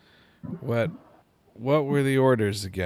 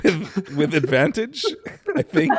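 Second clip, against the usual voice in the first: laughing; 7 dB louder than usual. Real, not acted. Player laughs while asking roll.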